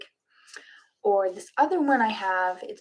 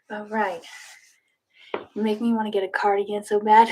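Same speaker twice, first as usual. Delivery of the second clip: ordinary talk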